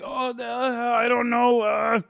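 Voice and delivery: mock him fumbling for words, dragging it out; mocking impression. Streamer mocking the man caught without an answer, flustered stammer. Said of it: "he was just like".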